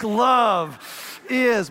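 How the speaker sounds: carve the words like scribbling angrily; tough, determined "Love is"; scratched into a desk. Shown as a demonstration. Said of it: "scratch it in a desk".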